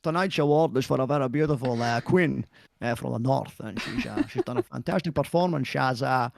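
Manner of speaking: slushy-s mock Scottish presenter; halting awards speech in a put-on Connery accent; Sean Connery. Husband presenting his wife's award, hammed-up Connery accent. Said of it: "Presented by Sean Connery".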